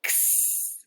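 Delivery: x as a breathy unvoiced ks hiss; x sound. Shown as a demonstration. Teacher modelling the letter sound for kids, a whispered ks. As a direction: make x as a soft k then s, no voice. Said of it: "You try".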